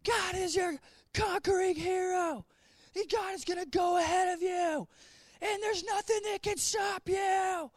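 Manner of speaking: high, shouting preacher parody; mock young preacher. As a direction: ham up the overeager young preacher. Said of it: "standing up here".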